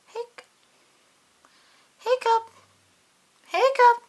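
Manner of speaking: high, loud hiccup sounds; squeaky hiccups. Acted. Pete the kitty hiccuping over and over. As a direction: hiccup sharply, twice.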